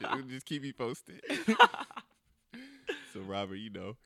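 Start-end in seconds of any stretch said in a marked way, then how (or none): 0.0-1.2 slow, joking, laughing through it. Real, not acted. Interviewer to the guest who'll cry on the way home, laughing. 1.2-2.0 big real laugh; loud laugh. Real, not acted. Interviewer joking with the guest, loud laugh.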